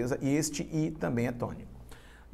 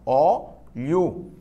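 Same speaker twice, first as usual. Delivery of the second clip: "óleo" split into ó and leo; two separate syllables; 4 dB louder than usual. Shown as a demonstration. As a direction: say "óleo" in two drawn-out beats. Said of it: "são duas sílabas só".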